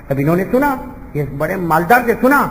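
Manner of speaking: usual speaking voice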